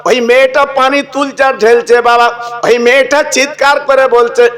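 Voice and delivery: lilting, chanted preacher's telling; sermon storytelling. Acted. Preacher telling the crowd a saint's tale, chanted sermon voice.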